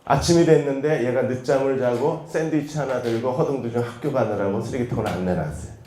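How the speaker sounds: ordinary talk, nothing marked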